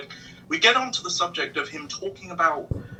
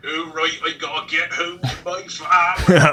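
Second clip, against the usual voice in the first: louder, broad English farmer voice; English country accent; 6 dB louder than usual. Shown as a demonstration. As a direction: play a drunk English farmer heading home. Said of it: "I go full, full into it".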